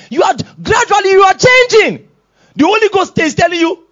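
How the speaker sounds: high, loud pulpit warning; fired-up preacher; forceful preaching. Real, not acted. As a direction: cry it out to the congregation.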